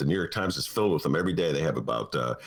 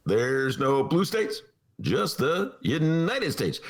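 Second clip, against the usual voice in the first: slow and higher, stretched; Obama impression; 7 semitones higher, much slower than usual. Host redoing Obama slower on cue. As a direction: draw out each word like a speech. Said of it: "Talk slow, then fast".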